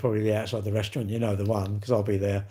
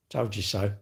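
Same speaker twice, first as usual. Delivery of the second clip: smug inner told you so; 5 semitones lower, 6 dB quieter than usual. Pretend. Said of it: "And in your mind go".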